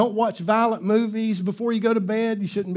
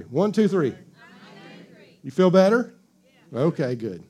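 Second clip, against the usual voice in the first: ordinary delivery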